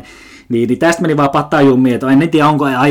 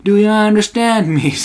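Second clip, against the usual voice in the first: higher, slow English repeat; milder mock customer; 9 semitones higher, 3 dB louder, slower than usual. Smoker repeating question to cashier, higher slow mock. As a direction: repeat the demand a bit less loudly. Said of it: "alkoi sit jotain heittää".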